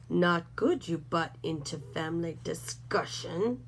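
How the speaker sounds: a dim brute grumbling; gruff coyote Snort. A narrator's reading. Snort's broken speech.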